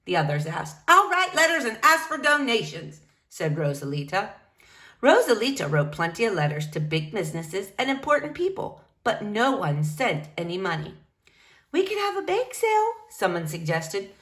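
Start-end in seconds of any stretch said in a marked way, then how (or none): 0.9-3.0 a bit higher, upbeat; brisk can-do tone; lightly voiced determined librarian. Rosalita resolves. 11.7-12.9 higher, tentative; lightly voiced hopeful staffer. Staff member suggests.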